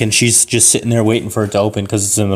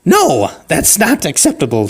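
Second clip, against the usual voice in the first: high granny voice, firm; mock-stern old lady; refusing old lady; 6 semitones higher than usual. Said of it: "And she's like".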